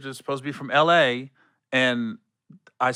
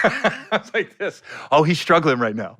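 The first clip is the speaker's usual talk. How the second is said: laughing; 3 semitones higher, 3 dB louder than usual. Real, not acted. Laughing retelling.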